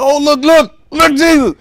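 mock amazed disciples tugging at Jesus; excited disciples. Disciples calling Jesus over to the withered tree, loud and amazed. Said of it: "He said".

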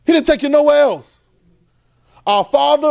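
loud, high-pitched preaching; rising sermon fervor. Acted. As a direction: preach it out with full force. Preaching at full force.

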